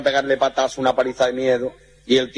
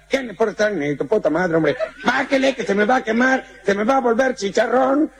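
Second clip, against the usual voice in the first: put-on high Mexican-accented shout up the building; hammed-up Mexican yelling upward; Mexican calling up; 9 semitones higher than usual.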